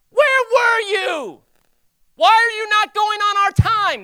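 very high, loud, drawn-out demand; hammed-up indignant yell; accusing shout. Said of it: "Rather than like".

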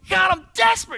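high, loud cry to God; desperate cry. Retold or imitated. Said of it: "and you go".

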